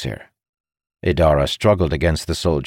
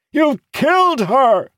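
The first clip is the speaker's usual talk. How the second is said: very high, drawn-out yell; anguished scream; 5 dB louder than usual. A narrator's reading. Captain repeating his accusation after them, screaming. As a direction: scream it, stretching the words. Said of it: "he shouted".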